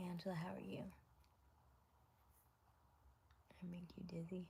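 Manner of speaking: soft breathy whisper, barely voiced. Real, not acted. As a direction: lean in and whisper to Angela. Whispering greeting to Angela.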